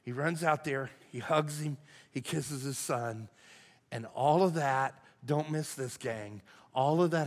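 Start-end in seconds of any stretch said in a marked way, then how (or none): none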